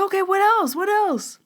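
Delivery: high, loud, eager demand; imitated freak-out. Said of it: "freaking out".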